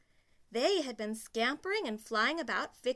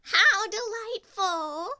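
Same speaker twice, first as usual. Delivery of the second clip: much higher, louder and slow; delighted stepsisters; 12 semitones higher, 11 dB louder, slower than usual. Acted. Stepsisters to each other, high and gushing. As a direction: gush with glee. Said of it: "the stepsisters said to each other".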